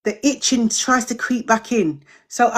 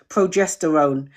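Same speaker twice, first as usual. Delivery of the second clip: "progesterone" carefully sounded out, lower; slow, careful pronunciation; 6 semitones lower than usual. Real, not acted. Correcting own stumble for viewers, slow careful "progesterone". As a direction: say "progesterone" slowly, syllable by syllable. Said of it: "I have to say it slowly".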